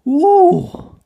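loud, high "ooh" of horror; high-pitched cry of dread; horrified gasp. A narrator's reading. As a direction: gasp aloud in alarm. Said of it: "gasped little engines in horror".

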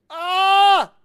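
high, loud yell; short burst of full-voiced screaming; excited scream. Real, not acted. Player reacting to the game, excited scream.

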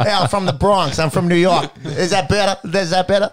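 playful put-on New York accent. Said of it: "No one can help that accent out".

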